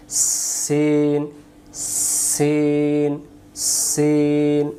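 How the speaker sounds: সিন with a very long hissing s, slowly; hissing s sound. Shown as a demonstration. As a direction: draw out the hiss of সিন, slow. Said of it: "শিশি দিয়ে পড়তে হয়". (শিস দিয়ে ধীরে সিন)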